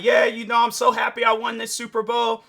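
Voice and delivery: loud, high hammed-up winner's shout; jokey imitation of a victorious player; mock Travis Kelce. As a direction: play the champ yelling into the mic. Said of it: "so he's all like".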